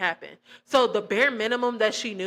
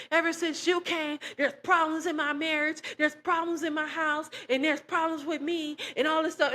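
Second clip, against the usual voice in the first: higher-pitched mock of an angry mom ordering her out; retelling mom's rant, higher and accusing; lightly voiced angry mother; 6 semitones higher than usual. Mother yelling to leave her house.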